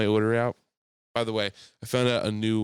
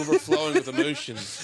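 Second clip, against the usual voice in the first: laughing; 3 dB louder than usual. Real, not acted. Explaining word while laughing.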